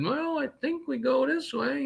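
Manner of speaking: high, hesitant guessing voice; unsure trucker. Retold or imitated. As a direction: guess the route aloud. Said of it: "a number of the trucks would".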